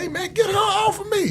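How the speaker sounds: mock panicked plea. Begging his friend at the club, mock panicked high plea. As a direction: plead in hammed-up alarm.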